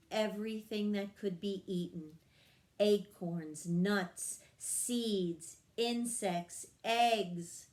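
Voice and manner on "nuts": ordinary delivery, nothing marked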